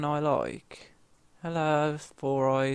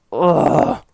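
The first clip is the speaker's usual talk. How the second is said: louder, higher groan of frustration; loud disgusted ugh; 3 semitones higher, 11 dB louder than usual. Real, not acted. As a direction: groan at losing. Frustrated after death.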